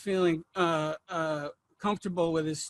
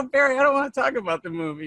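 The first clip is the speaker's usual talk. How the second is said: exasperated self; 6 semitones higher, 4 dB louder, faster than usual. Retold or imitated. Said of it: "I go".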